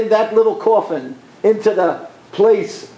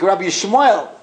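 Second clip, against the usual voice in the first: usual speaking voice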